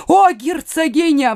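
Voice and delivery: high, fervent appeal; imploring. A narrator's reading. Father appeals to the duchess, imploring. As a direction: implore her, pitched high. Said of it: "взмолился Меркандон".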